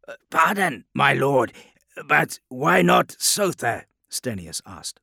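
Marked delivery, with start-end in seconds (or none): 0.1-3.9 loud, high officer, halting before the question; slow down as you dare to question your lord; hesitant challenge. A narrator's reading. Stenius querying the Lion's choice of destination, hesitant.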